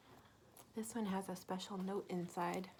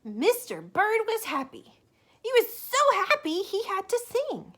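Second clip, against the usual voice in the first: bright, loud read-aloud voice; storybook reading; 13 semitones higher, 14 dB louder than usual. A narrator's reading. Teacher reading a picture book to children, lively and loud. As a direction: read it big for the little ones.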